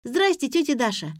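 put-on high, happy little-girl greeting; delighted child. A narrator's reading. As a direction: chirp hello like a pleased kid. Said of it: "увидела меня и обрадовалась".

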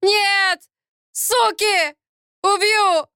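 high, strained screams of rage; furious yelling. A narrator's reading. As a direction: yell threats in desperate rage. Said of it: "заорал пленник". (яростный крик)

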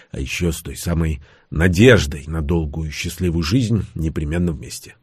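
usual speaking voice

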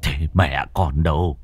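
subdued, softened tone. Acted. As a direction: ask tenderly after her. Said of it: "dịu giọng".

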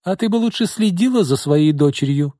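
acted indignant accusation; accusing declaration. A narrator's reading. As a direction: reproach your sister sharply. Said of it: "заявила она".

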